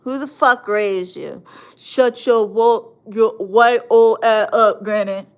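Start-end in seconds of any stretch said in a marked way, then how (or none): 1.8-5.3 put-on tough-boy voice; mocking thug talk. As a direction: mimic a trash-talking kid, loudly. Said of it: "You don't sound cool talking like that".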